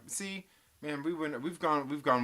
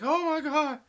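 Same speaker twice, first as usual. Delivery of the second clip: much higher, louder mock horror; mock horrified gasp; 13 semitones higher, 7 dB louder than usual. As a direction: parody of a shocked onlooker. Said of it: "a couple like".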